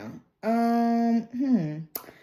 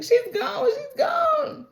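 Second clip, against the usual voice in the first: much higher, cried-out repeat; anguished cry; slower than usual. Retold or imitated. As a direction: wail it like a daughter in shock. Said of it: "And she's just like".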